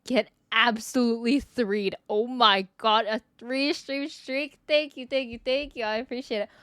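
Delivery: usual speaking voice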